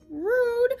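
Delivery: rude punched high and loud; stress on rude. Real, not acted. Teacher complaining about teens, rude stressed high and loud. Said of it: "some of them are rude".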